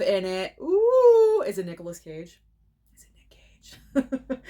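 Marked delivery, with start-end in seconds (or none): 2.9-4.4 slow, breathy teasing whisper. Real, not acted. Guessing the star to viewers, whispered. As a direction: whisper the name like a secret.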